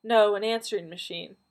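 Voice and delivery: ordinary delivery, nothing marked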